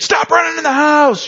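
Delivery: high, sharp mock scream; imitating an in-law shrieking at a child; mother-in-law's scream. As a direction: screech it like a scolding mother-in-law. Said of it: "your mother-in-law screams at your kid".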